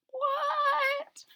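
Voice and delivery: very high squeak; surprised. Real, not acted. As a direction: pop up in disbelief. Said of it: "narrator's surprised reaction".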